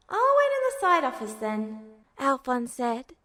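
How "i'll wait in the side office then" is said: a little higher, trying hard to sound cheerful; forced cheerfulness. A narrator's reading. Alphonse hiding his worry from his brother, forced cheer. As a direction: put on a brave, bright face. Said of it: "He sounded like he was trying to be cheerful".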